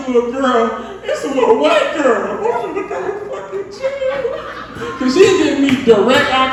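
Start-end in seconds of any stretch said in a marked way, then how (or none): 2.4-4.3 stunned and cracking up; dad stunned by the body rolling; shocked disbelief. Retold or imitated. Shocked by girl dancing.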